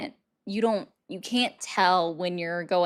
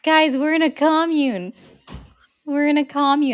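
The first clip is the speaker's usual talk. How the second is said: loud, higher mock-shocked announcement; amused exclaiming; 7 semitones higher, 8 dB louder than usual. Real, not acted. Streamer tells chat the commune rumour, loudly amused. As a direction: proclaim it to chat in amused disbelief. Mock outrage at chat's claim.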